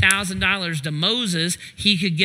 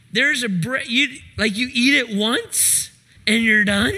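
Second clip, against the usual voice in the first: mock amazement; 4 semitones higher than usual. Mock crowd marveling at bread.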